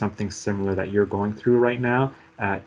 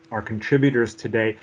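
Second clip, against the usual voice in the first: ordinary talk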